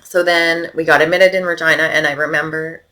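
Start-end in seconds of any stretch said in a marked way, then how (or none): none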